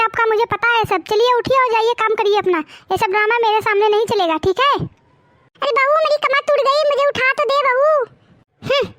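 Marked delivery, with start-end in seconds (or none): 5.6-8.9 put-on pleading old woman. Old woman begging for help.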